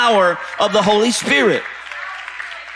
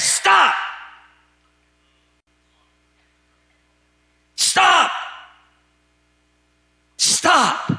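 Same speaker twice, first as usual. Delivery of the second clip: stern stop; 7 semitones higher, much slower than usual. Acted. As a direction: bark the order like an apostle. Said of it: "he changes the tone".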